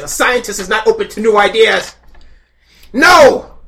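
loud high cry building to "No!"; anguished shout. Acted. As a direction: wail it in mock horror. Scientist panics about changing.

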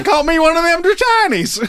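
put-on playful Southern accent, pitched high. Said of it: "like, Southern accent".

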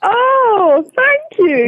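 high-pitched, gushing gratitude; delighted thanks. Real, not acted. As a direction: thank them with rising delight. Thanks for promised book purchase.

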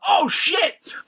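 high-pitched alarmed yelp; comic panic. Retold or imitated. Two friends seeing the smashed door, comic panic. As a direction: blurt it like a caught culprit.

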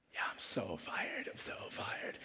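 slow whisper to yourself; acted guard's hushed panic; whispered dread. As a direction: whisper it as if you're doomed. Guard muttering in dread to himself.

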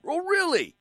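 high, incredulous "Oh, really?"; sarcastic disbelief. Real, not acted. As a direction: scoff at them in mock disbelief. Sarcastic retort to listeners.

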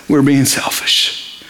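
hushed, slow realization; whispered confession. Retold or imitated. Lepers realizing their greed, hushed whisper. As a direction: breathe it like a guilty secret.

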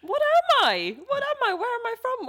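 high-pitched mock panic; mock identity crisis. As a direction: wail it in pretend bewilderment. Mock identity crisis after accent remarks.

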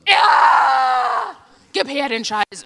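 loud grabby yell; greedy shout. Retold or imitated. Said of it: "die Deutschen waren so".

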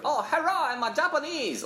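high, squeaky caricature of a Japanese accent; cartoonish Japanese accent. Shown as a demonstration. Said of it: "doing it in a cartoonish way".

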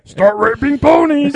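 slow, high-pitched orc battle cry; drawn-out, hammed-up orc roar. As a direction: bellow it like a cartoon orc. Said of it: "I can do the orc voice".

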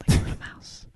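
tiny voiceless whisper; playful mouse whisper. Pretend. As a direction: play along in a teeny, faint whisper. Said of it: "You sounded like a mouse".

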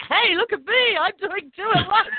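high, showy brag; mock boastful. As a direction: pitch it up like showing off. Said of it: "You know, like".

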